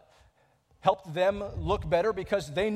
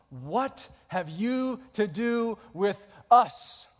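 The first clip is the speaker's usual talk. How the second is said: slow, loud, drawn out demon's question; demon's cry; 4 semitones higher, 5 dB louder, much slower than usual. Acted. Pastor voicing the demon confronting Jesus, slow and loud. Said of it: "And he says".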